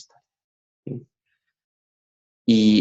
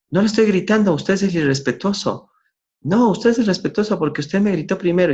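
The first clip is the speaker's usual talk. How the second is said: mock shouting match; 9 semitones higher than usual. Retelling a facilitator arguing with a student, mock yelling. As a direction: play both sides of a classroom quarrel.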